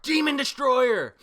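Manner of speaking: loud, high, slow attack name; attack yell. Retold or imitated. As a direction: belt out the move name. Calling out an attack.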